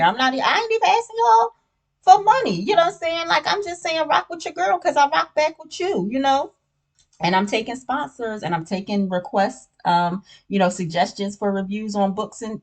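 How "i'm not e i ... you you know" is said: much higher, pleading to viewers with mock outrage; playful plea. Real, not acted. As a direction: coax the audience, half-joking indignation. Begging viewers to subscribe.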